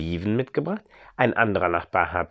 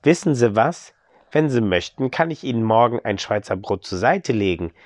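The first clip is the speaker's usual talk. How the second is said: ordinary delivery, nothing marked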